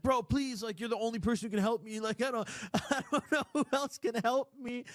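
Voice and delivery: high, pleading panicky voice; panicked friend. Retold or imitated. Friend on FaceTime begs him to come help, high pleading panic. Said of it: "He's like".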